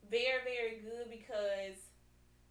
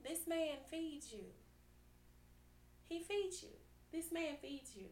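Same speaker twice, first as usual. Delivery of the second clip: soft emphasis; 3 semitones higher, 8 dB quieter than usual. Acted. Tender aside to the ladies.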